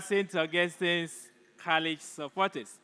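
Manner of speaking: ordinary delivery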